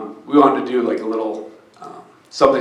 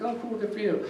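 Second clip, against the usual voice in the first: high, soft imitation of a mail carrier's polite approval; high little mock "good for you" voice; mail lady; 10 semitones higher, 9 dB quieter than usual. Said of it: "So the mail lady".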